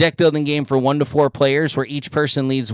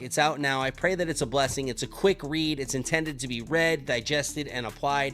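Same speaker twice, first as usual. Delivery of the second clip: soft, sincere promo over music; ad read; 5 dB quieter than usual. Acted. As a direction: pitch the book gently to listeners.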